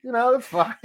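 high, amused shrug of a voice; accepting the overlord title with a high shrug; shrugging acceptance. Retold or imitated. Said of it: "I was like".

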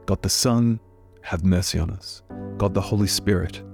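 ordinary talk

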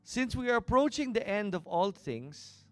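loud, rising preacher reading of scripture; preaching scripture. Acted. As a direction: proclaim the verse with growing force.

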